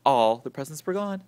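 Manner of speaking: ordinary delivery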